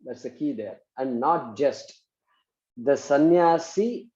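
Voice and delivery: slow, spaced-out dictation. Shown as a demonstration. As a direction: dictate slowly for students to write.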